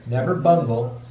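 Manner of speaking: ordinary delivery, nothing marked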